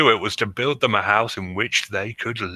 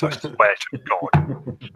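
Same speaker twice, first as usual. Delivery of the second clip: pirate voice; 3 semitones higher, slower than usual. Acted. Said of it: "I will do the pirate voice".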